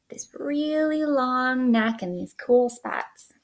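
slow hushed whisper. Acted. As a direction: whisper it like a secret clue. Teacher whispers hint to kids.

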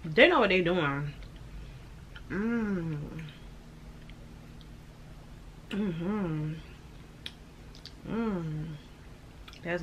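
talking while chewing cookie; muffled hums between bites; mouth full, chewing. Real, not acted. Seen 1: Holds cookie, closed-mouth chewing throughout after biting at -12.

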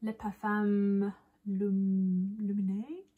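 slow, halting mock French; fake French accent. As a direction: sound out the French name badly. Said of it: "This is me pretending to speak French".

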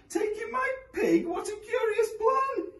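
put-on high, whiny old woman; old lady voice. A narrator's reading. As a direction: whimper it like a fed-up granny.

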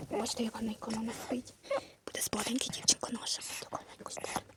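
very soft near-whisper; hushed. Pretend. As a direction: keep it down by a sleeping baby. Baby is falling asleep.

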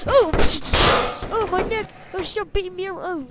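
high, loud pretend yelp of pain; hurt toy crying out; mock pain cry. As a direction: squeal as if your neck is caught. Toy victim gets hurt.

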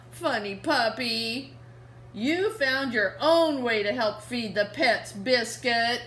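slow, fond praise of the puppy. Acted. Reading to kids, the girl fondly praises Biscuit.

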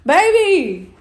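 loud, high holler after a partner; real loud, high-pitched call. Real, not acted. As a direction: yell the pet name to get attention. Calling after partner who refuses.

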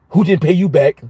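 normal talking voice

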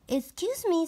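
high polite princess. Acted. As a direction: ask sweetly.

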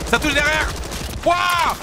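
high, loud alarmed yell; excited shout. Real, not acted. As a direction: shout it as you get hit. Hit from behind in game.

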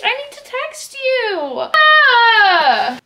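crying with happiness; real tears. Real, not acted. Moved to tears by Tatiana's gift, crying. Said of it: "I could cry right now".

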